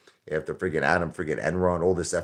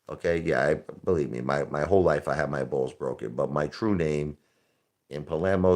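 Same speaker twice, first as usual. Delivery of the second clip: normal talking voice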